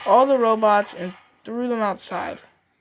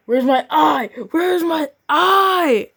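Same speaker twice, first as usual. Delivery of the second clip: higher and louder, the last take slow and drawn out; rising whiny wail; 8 semitones higher, 5 dB louder, slower than usual. Acted. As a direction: wail it like a broken toy. Character wails for lost eye.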